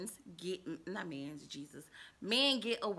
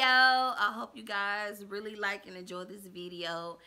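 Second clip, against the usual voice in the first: ordinary delivery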